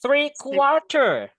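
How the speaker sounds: "quarter" drawn out slowly and loudly; slow, stressed example. Shown as a demonstration. Teacher showing mixed usage, slow stressed "quarter". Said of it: "No, tapi".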